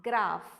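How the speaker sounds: "graph" as GRAAF, long vowel, ending in F; model pronunciation. Shown as a demonstration. Teacher showing how to read the word, firm model pronunciation. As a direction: say "graph" firmly, PH as F.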